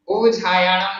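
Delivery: उपज्जायाणम with no length on वा, shown as an error; wrong pronunciation. Shown as a demonstration. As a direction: rush through वा in उपज्जायाणम as the wrong way. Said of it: "नहीं है".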